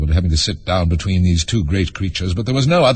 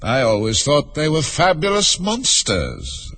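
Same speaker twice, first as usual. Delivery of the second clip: slow, drawn-out, higher creature voice; higher, unhurried storybook unicorn; haughty unicorn; 7 semitones higher, slower than usual. A narrator's reading. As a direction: voice a unicorn, drawing the words out.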